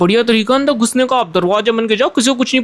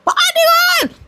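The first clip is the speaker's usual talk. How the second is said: angry aunty voice; slower than usual. Acted. Aunty yells at intruder.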